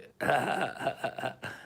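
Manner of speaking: imitated high-pitched, raspy stoned laugh; hoarse stoner laugh. Recalling being stoned on his show, hoarse stoner laugh. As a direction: wheeze out a weed-hoarse chuckle. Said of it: "I'd be all hoarse from all the weed".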